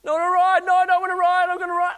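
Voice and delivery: put-on very high, loud wailing kid; crying child. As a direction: whine like a six-year-old refusing. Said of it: "he starts crying".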